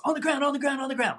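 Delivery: high, rapid retold cop shouts; imitated police command. Armed officers ordering the team down, imitated urgent yell.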